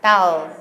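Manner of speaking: low 'thou' with a dark, w-like ending; tongue pulled back. Shown as a demonstration. Teacher having trainees copy foreigners, thou with retracted tongue. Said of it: "얼른 뒤로 가져가는 거".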